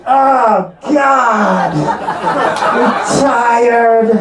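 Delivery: wife moaning she is worn out; tired wife groan. Retold or imitated. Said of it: "She goes".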